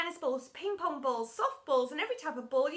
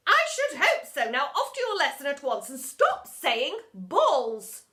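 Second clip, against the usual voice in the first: stern scolding; 8 dB louder than usual. A narrator's reading. Headmistress sends teacher off to his lesson, stern scolding.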